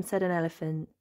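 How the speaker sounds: ordinary delivery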